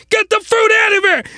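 high, strained yell; comic high-pitched outburst; mock outraged shout. Acted outburst at the scene.

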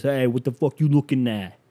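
put-on tough prison cat with a New York accent; put-on New York wiseguy; New York borough accent. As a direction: challenge them like a New York street tough. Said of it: "he talks like he's from one of the boroughs".

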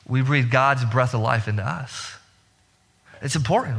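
ordinary talk

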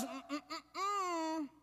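soft, drawn-out "mm-mm" of reproof; quiet, slow hummed scolding; disapproving hummed warning. Acted. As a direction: hum a slow no, like a parent's warning. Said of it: "God goes".

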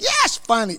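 retold triumph. Host recalling finally finding the filmmaker online, triumphant.

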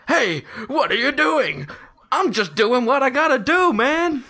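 high, loud character shout; scripted quote. Acted. Contestant acting out the full script, high hammed-up quote. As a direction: act it out high and full of gusto. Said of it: "if you want the full script".

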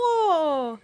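loud real sneeze; a sudden loud sneeze; sneezing. Real, not acted. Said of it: "Saúde!".

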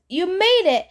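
excited relief. Acted. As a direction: burst out happy to see friends arrive. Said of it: "Grover ran up breathless".

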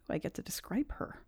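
whispered aside. Real, not acted.

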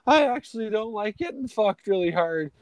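high-pitched girly imitation; mock reluctant girl. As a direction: mimic a girl objecting in falsetto. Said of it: "It's just like".

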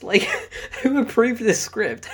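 ordinary delivery

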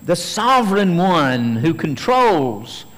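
loud, high, fervent preaching climb; preaching at full force. Acted. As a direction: proclaim it to the back row. Preacher building to a peak.

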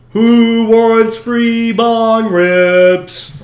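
loud, very slow yell from afar; yelled call. Retold or imitated. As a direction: call out across the street, stretching it. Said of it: "I hear somebody yell out".

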